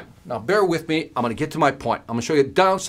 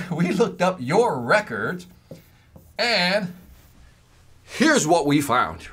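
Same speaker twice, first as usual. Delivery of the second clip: slow salesman line; 2 semitones higher, much slower than usual. Retold or imitated. Adviser's slow rehearsed line.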